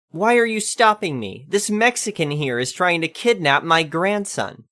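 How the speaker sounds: high indignant protest; lightly voiced outraged grandma act; indignant old lady.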